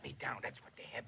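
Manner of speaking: whisper. Acted. Whispered complaint after shrinking.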